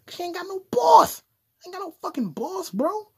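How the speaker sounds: high, loud boast; defiant outburst. Pretend. Podcaster bragging he has no boss, defiant high yell. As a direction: shoot way up in mock defiance.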